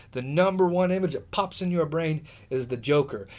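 ordinary delivery

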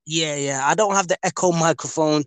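usual speaking voice

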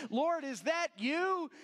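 slow high startled cry to the sky; startled call. Retold or imitated. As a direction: call up to heaven, rattled. Startled woman calls to heaven.

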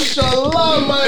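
excited shout. Real, not acted. Cheering the girlfriend news.

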